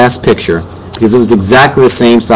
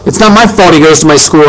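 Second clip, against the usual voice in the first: higher, upset protest; crying out; 7 semitones higher, 3 dB louder than usual. A narrator's reading. Boy defending himself to parents, crying out. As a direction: cry out in self-defense, pitch raised. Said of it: "I cried".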